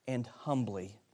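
normal talking voice

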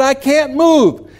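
high, slow mock-desperate holler; calling up. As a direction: play a stuck kid calling for help. Stuck boy calls up to uncle.

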